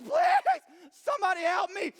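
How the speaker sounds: high, loud desperate wail; hammed-up plea shout. Player getting beaten begs for help, loud mock desperation. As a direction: scream for rescue, played for laughs.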